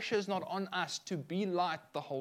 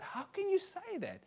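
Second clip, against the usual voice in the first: much higher, mock-outraged disbelief; incredulous; 4 dB quieter than usual. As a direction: retell the shock playfully. Retold shocked thought.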